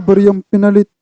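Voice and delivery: ordinary talk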